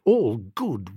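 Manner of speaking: plain reading voice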